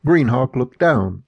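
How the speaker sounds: ordinary delivery, nothing marked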